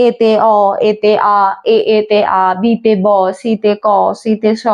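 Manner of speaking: low, even-toned chant of letters and sounds; rote reading. Shown as a demonstration. Teacher showing students how not to read, rote recitation. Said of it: "এইরকম ভাবে পড়বে না".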